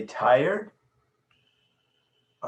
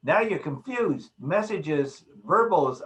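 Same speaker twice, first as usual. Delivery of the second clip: ordinary talk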